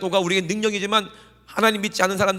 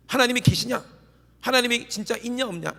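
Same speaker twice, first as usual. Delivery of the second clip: doubting challenge; 7 semitones higher than usual. Retold or imitated. Voicing people doubting God.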